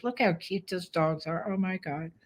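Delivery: soft aside. Real, not acted. Trainer gushing to viewers over cute dogs, soft aside. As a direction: drop the voice and gush quietly over the dogs.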